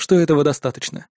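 plain reading voice

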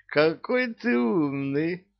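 high, slow amazed praise; marvel, a little hammed up; mock amazement. A narrator's reading. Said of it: "поразился дьявол". (наигранное изумление)

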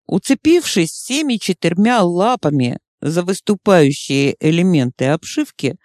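usual speaking voice

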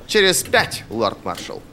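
high start, dropping on the title; obedient acknowledgement. A narrator's reading. General accepts lord marshal's shorter deadline, dutiful. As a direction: repeat the order back dutifully.

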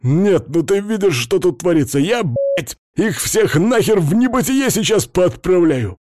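high-pitched ranting hamster fury; hysterical rage. A narrator's reading. Hamster threatens to shoot them all, raging.

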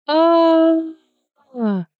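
high, drawn-out narrated scream; a long high "aaah" falling away at the end; frenzied scream. A narrator's reading. Heroine shrieking at a mouse by her feet, frenzied scream. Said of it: "иступлённо орала я".

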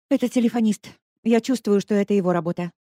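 Lesya, pale on the bus, frightened; frightened blurt. A narrator's reading. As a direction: blurt it out, scared. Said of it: "выдала Леська".

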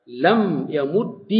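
slow لم يمد, pronounced "lam yamuddi"; final kasra. Shown as a demonstration. Teacher demonstrating another allowed form, يمد ending in i. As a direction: draw out لم يمد, ending the doubled d on long i. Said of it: "أو بكسر".

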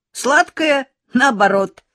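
Сладкое jumps high, teasing laughter in the voice; mocking laugh. A narrator's reading. Top pupil Sashka mocking Lyolka's words, laughing at her. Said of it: "засмеялся отличник Сашка".

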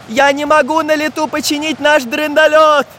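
high, distressed protest; desperate pleading. A narrator's reading. Самоделкин cannot fix the craft in flight, pleading in distress. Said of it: "умолял Самоделкин".

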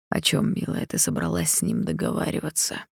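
hushed unvoiced whisper. A narrator's reading. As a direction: whisper it tensely, low. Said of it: "спросил почему-то шёпотом".

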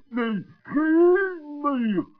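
offended whimper. Acted. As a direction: sulk like a hurt child. Gregorius sulking, offended.

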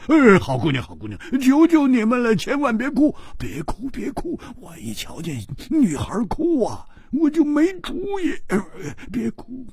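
high, flustered begging; anxious pleading. Acted. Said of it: "胡铁花着急地说". (着急地连声哀求)

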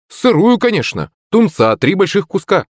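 high-pitched emphatic order; exclaiming. A narrator's reading. Akulych orders raw fish from waitress, exclaiming high. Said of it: "воскликнул Акулыч".

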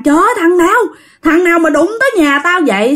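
mock-yell a threat at an unknown attacker; retold angry yell. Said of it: "chú Lâm mới la làng". (giả giọng chú hung dữ la làng)

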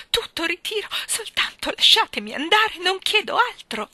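high, loud, desperate begging; gasping plea. A narrator's reading. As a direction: beg frantically to be let go. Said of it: "boccheggiò la signorina Andrew". (supplica disperata, acuta e forte)

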